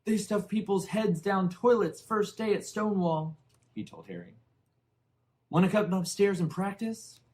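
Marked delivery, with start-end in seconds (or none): 0.0-3.4 put-on loud, high sneering boy; high loud schoolboy taunt; mocking bully. A narrator's reading. Dudley taunting Harry. 5.5-7.2 put-on loud, high sneering boy; high loud schoolboy taunt; mocking bully. A narrator's reading. Dudley taunting Harry.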